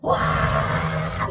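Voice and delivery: long, drawn-out cry of awe; acted shout. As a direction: shout out as if witnessing a wonder. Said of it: "소리를 지릅니다". (놀라서 길게 외치는 소리)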